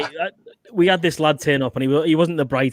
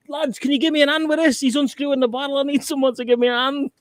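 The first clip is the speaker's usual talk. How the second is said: higher, pleading call for help; a hapless recruit begging the lads for a hand; 10 semitones higher than usual. Retold or imitated. Recruit calling others for help.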